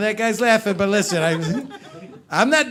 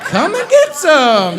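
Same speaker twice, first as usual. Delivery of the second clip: much higher, louder and slow lounging come-on; a toad sprawled on a lily pad beckoning; hammed-up toad inviting lickers; cocky toad; 9 semitones higher, 11 dB louder, slower than usual. Comic voicing himself as a toad.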